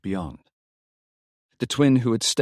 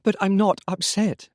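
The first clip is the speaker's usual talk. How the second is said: interrupting; 9 semitones higher than usual. A narrator's reading. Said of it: "she interrupted".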